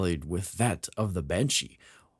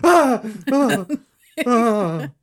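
high, loud hammed-up screams of horror; mock horrified scream; 9 dB louder, much slower than usual. Mocking the colonel's terror at the face. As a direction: scream like you've seen a ghost, for laughs.

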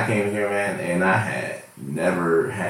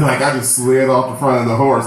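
normal talking voice

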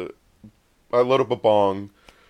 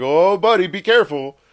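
raised, louder mock warning; worried calling-out; 6 semitones higher, 4 dB louder than usual. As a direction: call after a friend running off. Calling after friend on stairs.

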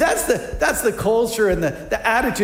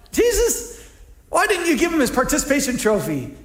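mock indignation; 7 semitones higher than usual. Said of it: "you're like".

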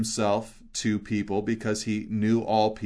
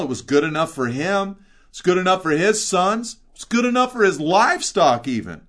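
mock defiant; 7 semitones higher, 5 dB louder than usual. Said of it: "draws a contrast and says".

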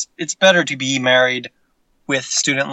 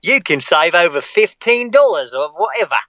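Cockney pitch; 4 semitones higher than usual. Pretend. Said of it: "do voices".

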